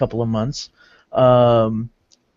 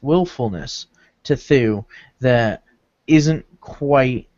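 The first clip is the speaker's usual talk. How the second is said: usual speaking voice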